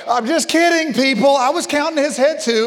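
high, loud comic backpedal; joking protest. Real, not acted. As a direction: raise it high to reassure the crowd you're joking. Jokingly retracting a gag to audience.

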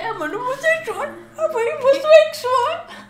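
high wailing fake sob with laughter; mock crying. Friend retelling the actress's exaggerated crying confession, in a wailing mock sob. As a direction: imitate her overdone crying, laughing through it. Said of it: "lo dice todo llorando".

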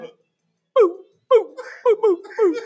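high, loud playful boops; imitated alert sound. Telling viewers his paycheck vanishes, playful boops. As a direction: mimic the bank notification chime. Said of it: "Y'all hear that?".

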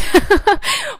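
sudden high tearful laugh; high laugh while welling up; teary laugh. Real, not acted. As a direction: burst into a laugh on the edge of tears. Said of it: "starting to cry".

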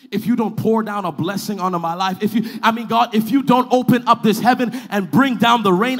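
loud, defiant prayer in a preacher's cry; full-force preaching. Acted. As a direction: drive each phrase hard at heaven.

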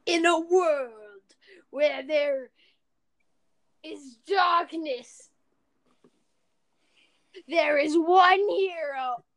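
slow, booming trailer announcer; movie trailer voice. Pretend. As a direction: intone it like an epic movie trailer. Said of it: "What do you think about movie ads".

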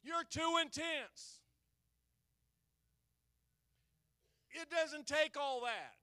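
loud fiery preaching; preaching at full force. Acted. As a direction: preach it out to the congregation.

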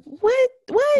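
very high, loud questioning yelps; incredulous. Acted. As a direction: squawk it in exaggerated disbelief. Acts out disbelief at excuse.

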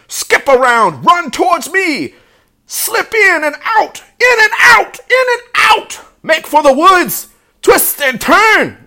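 very high, frantic goose shrieks; loud commands, pitched sky-high; goose giving orders. A narrator's reading. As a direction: shout urgent commands as an excited goose. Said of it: "began to give orders".

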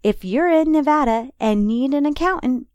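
upbeat radio ad voice; ad read. Acted. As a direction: read it like a sponsor spot. Sponsor ad read.

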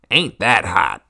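loud twangy question. Acted. Hick voice continues.

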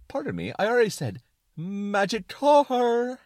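high, loud, emphatic retort; excited suspenders. Acted. Suspenders of disbelief insisting to Brain, excited and loud. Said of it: "talk in an excited tone".

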